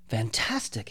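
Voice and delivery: breathless awed whisper; a hushed, amazed whisper. Acted. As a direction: whisper it in wonder. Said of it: "he whispered".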